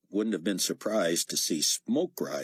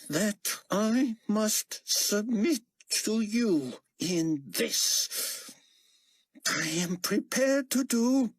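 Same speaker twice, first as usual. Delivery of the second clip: grudging Nikolai voice. Acted. Nikolai forced concession, slow.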